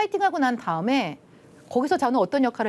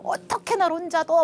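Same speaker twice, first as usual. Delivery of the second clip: retold angry outburst; 8 semitones higher than usual. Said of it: "미친 듯이 화를 내면서".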